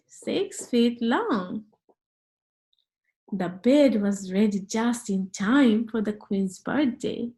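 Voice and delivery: ordinary delivery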